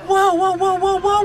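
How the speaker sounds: normal talking voice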